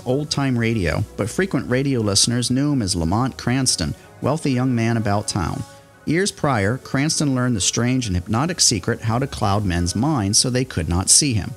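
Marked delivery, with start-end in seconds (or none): none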